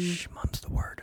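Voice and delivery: hushed, secretive whisper; conspiratorial mock hush; playful whisper. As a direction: shush them as if sharing a secret. Playful hushing to keep a secret.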